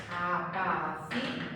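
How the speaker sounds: apaciguáis drawn out slowly, syllable by syllable, guáis kept as one. Shown as a demonstration. Teacher showing students a triphthong, apaciguáis slowly syllable by syllable. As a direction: break apaciguáis into slow, separate syllables. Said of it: "una sola sílaba".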